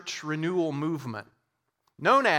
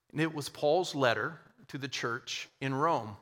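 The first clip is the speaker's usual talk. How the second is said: ordinary delivery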